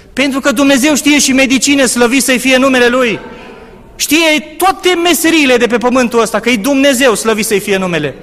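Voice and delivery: loud, high exclamations of praise; fervent preaching. Acted. Preacher praising God to the congregation, loud and fervent. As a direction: lift the praise up to the rafters.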